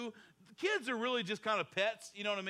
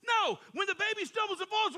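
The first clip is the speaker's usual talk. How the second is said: ordinary talk, nothing marked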